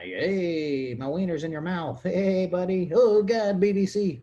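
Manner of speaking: high, loud, rising hammed-up glee; mock excited shout. Guy play-acting a moment with a buddy, mock excited shouting. Acting out buddies fooling around.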